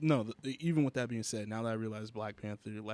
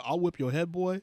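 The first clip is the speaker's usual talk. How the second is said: excited; 6 semitones higher, 6 dB louder than usual. Real, not acted. Quoting a song title excitedly.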